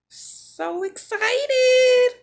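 high, loud squeal, drawn-out; excited squeal. Real, not acted. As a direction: squeal with delight, slowly. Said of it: "Let me hush".

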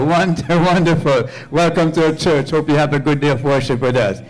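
ordinary talk

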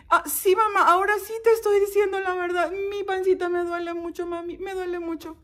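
put-on high, insistent child voice; pleading child. Child swearing to mom it truly hurts, pleading. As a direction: plead to be believed, high-pitched. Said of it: "Y mami".

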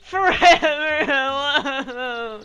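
high, very slow, drawn-out wail; mock lament. Gamer mocking himself after a bad pun, high slow wail. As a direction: moan it way up high like a lonely cartoon.

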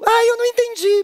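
put-on high puzzled kid voice; high, confused childlike voice; confused child. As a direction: play a kid who didn't get it.

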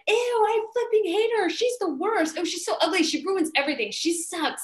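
high, disgusted mock jeering; hurling insults. Ensemble ad-libbing at Elphaba, hurling disgusted insults. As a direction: toss out catty insults one after another. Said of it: "hurling insult after insult".

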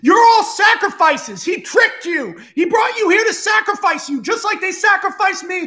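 high-pitched impassioned proclamation; theatrical outraged yelling, sustained high; rallying shouted speech. Acted. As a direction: expose the betrayal to a huge crowd. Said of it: "holds up the cube and he goes".

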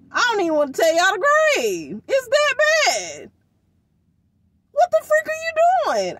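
high, loud outraged yell at a daughter; exasperated scolding. Retold or imitated. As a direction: pitch climbing high and loud in disbelief. Ranting at daughter about grade.

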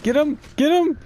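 excited shouting. Real, not acted. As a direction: yell get him again and again. Cheering teammates during chase.

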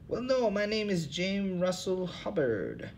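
a weary bum who cares for nothing, high; second hobo voice. Acted. Bum Hubbard answering the interviewer, in a high tired voice.

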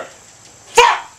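very high shouted curse; frustrated scream. Real, not acted. Gamer with ball in the water, screamed curse. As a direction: explode on one word.

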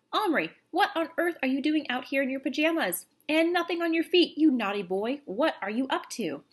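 high-pitched indignant telling-off; scolding father. A narrator's reading. Father catches son outside, scolds.

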